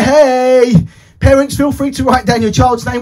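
ordinary delivery

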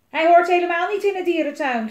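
high, loud indignant protest; yelling. A narrator's reading. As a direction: shout back in an argument. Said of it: "gilde Elise".

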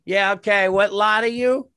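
a mock-bored gatekeeper asking the lot; bored camp clerk. Camp clerk at the window asks which lot, mock-bored. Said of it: "some fat chick opens the window and goes".

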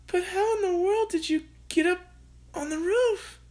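high, bewildered mother's voice; mother crying out. A narrator's reading. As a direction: ask in disbelief as the mother. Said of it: "cried Mother".

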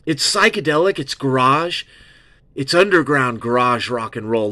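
ordinary talk

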